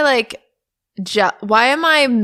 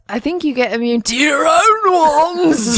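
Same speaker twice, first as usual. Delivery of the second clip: imitating him, rising and louder on "own ones"; mock his climbing "own ones"; mimicking his delivery; 6 semitones higher, 4 dB louder than usual. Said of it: "why'd you go".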